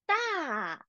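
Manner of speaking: 大 in the fourth tone, falling sharply from high. Shown as a demonstration. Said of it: "大 is the fourth tone".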